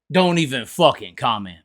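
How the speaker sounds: usual speaking voice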